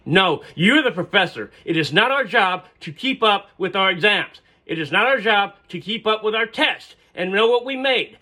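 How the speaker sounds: student yelling at her professor in class. Retold or imitated. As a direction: shout her point at the professor. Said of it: "she's actually yelling at this point".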